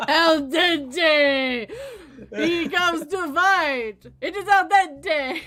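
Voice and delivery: loud, high, drawn-out wrestling intro; hammed-up wrestler entrance shout; lucha libre announcer. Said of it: "It's lucha libre style".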